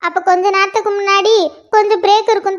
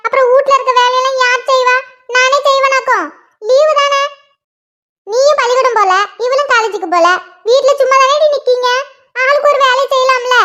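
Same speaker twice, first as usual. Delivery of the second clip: lightly voiced nagging mother; mother scolding; 4 semitones higher than usual. Mother finds her daughters sitting idle, nagging. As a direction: grumble at the lazy girls like their mum.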